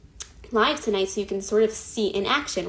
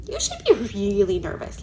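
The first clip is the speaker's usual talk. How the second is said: whispered, teasing rabbi; mock conspiratorial whisper. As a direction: whisper it like a playful secret. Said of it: "He would say".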